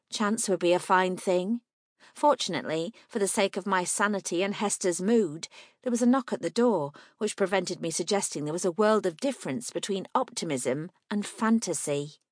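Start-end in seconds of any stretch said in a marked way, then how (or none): none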